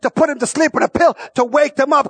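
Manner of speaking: preaching. Real, not acted. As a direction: preach it with fire to the congregation. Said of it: "I'm preaching this thing".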